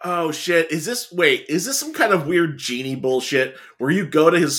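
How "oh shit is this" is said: ordinary talk, nothing marked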